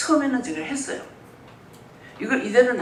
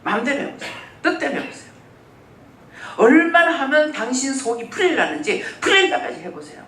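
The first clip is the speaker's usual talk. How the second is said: mock angry dare; 5 semitones higher, 4 dB louder than usual. Mock angry daring God.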